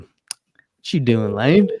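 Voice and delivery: slow, drawn-out playful taunt; mock-calling out to Lane; teasing call-out. Real, not acted. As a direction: rib your buddy watching the stream. Teasing Lane watching live.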